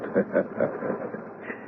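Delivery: a short soft laugh, almost silent; soft chuckle. Acted. Witch doctor chuckling softly at the accusing doctor. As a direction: chuckle under your breath, amused.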